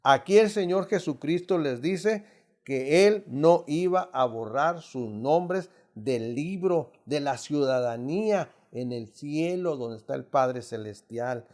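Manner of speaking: ordinary talk, nothing marked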